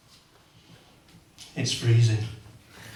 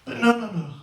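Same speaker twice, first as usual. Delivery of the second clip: ordinary delivery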